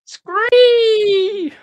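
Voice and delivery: loud high shrieking scree; pterodactyl shriek. Retold or imitated. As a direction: echo the pterodactyl cry, full volume. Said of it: "Absolutely comical".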